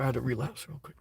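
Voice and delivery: muttered under the breath to himself; quiet repeat. Shown as a demonstration. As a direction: drop it to a near-whispered aside. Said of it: "sorry, I, I".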